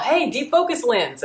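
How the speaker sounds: high-pitched mock call across a bar; retold yell. Bartender hailing a YouTuber from across the bar, mock yell. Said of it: "the bar yelled".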